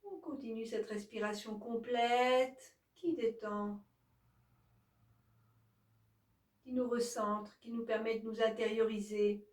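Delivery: calm, even yoga nidra guidance; guided relaxation. Acted. Yoga teacher guiding listeners to release each body part, calm guided relaxation.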